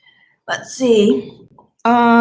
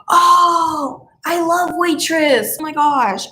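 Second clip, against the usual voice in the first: excited cry; 6 semitones higher, slower than usual. Real, not acted. Excited about the musical Waitress.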